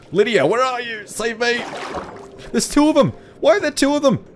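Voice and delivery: high, alarmed cries for help; panicked calling out. Real, not acted. Player to companion as two enemies appear, panicked calling out. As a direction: yell for rescue, pitch shooting up.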